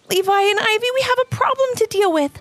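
high, bright small critter voice. A narrator's reading. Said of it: "shared Charlie".

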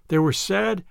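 usual speaking voice

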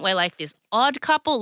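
usual speaking voice

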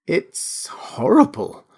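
slow, high, drawn-out horror; appalled, lingering on the word; horrified. A narrator's reading. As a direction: stretch "horrible" out, aghast. Kemp repeats his horror.

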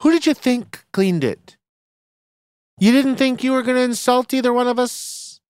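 high-pitched indignant questioning; mock offended comeback. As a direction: challenge her as the insulted colleague. Retort to the snooty newcomer.

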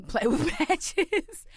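laughing. Real, not acted. Storyteller on the lesson learned to this day, laughing. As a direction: laugh through the words, stretching them out.

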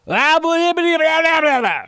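high silly blah-blah voice; mock gibberish. Shown as a demonstration. Reading a listener's blah email aloud in a high, silly voice. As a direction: read the blahs in a squeaky goofy voice. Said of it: "That's actually how he spelled it".

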